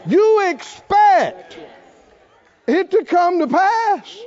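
loud, high, building preacher's cry; full-force preaching. Acted. Preacher urging the church to expect, loud and high.

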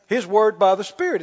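usual speaking voice

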